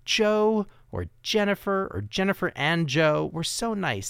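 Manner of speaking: mock-gush a donor shout-out; gushing thanks. Host mock-thanking imagined donors, high gushing voice.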